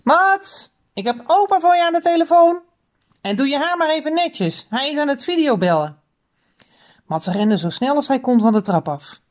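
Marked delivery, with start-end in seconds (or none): 0.0-6.0 high, sing-out call to someone upstairs. A narrator's reading. Mother calling Mats down to speak to grandpa, calling out. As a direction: call the child to the phone on a raised pitch. Mother calls son to the phone.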